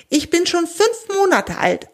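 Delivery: high-pitched, proud little squirrel. A narrator's reading. Young squirrel states its age to the animal, proudly. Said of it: "antwortet das Eichhörnchen stolz".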